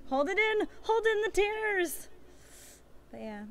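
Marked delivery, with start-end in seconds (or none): 0.1-2.1 high, strained mock fight against tears; playfully hammed-up near-crying; holding back tears. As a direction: act out choking back a cry. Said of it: "I almost cried, like, four times".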